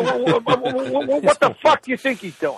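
high, stammering mock outrage; exasperated sputter. Son losing patience with his confused mother, sputtering in exasperation. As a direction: stumble into an incredulous question.

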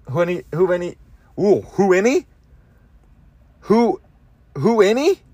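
trying out "who any" like a line of Shakespeare; savoring a made-up phrase. Shown as a demonstration. Testing a nonsense phrase he likes, playfully rising. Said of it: "Who any sounds like some Shakespeare shit".

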